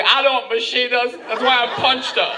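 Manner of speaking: high-pitched, hammed-up self-defence; pleading protest. Tourist answering the policeman's question, pleading protest. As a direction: explain yourself to the cop in mock desperation.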